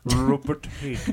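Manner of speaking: Swedish accent. Shown as a demonstration. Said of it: "if you don't say it like that".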